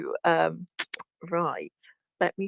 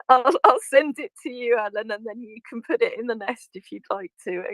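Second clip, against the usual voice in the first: laughing through the words, pitched up; 5 semitones higher than usual. Real, not acted. Teacher answering the host, still giggling and pitched up. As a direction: talk while still giggling.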